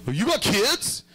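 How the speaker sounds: high, slow, drawn-out disbelief; mimicking stunned people; a hammed-up gasp of surprise; mock shocked. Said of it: "they act all fucking surprised and shocked".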